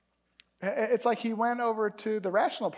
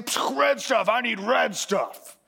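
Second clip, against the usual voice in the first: mock caveman demand, drawn out at the end; hungry caveman Esau; 3 semitones higher, slower than usual. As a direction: grunt it like a starving caveman. Retelling Esau's crude demand.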